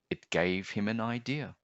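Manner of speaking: ordinary delivery, nothing marked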